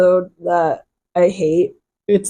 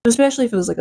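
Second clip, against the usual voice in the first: ordinary talk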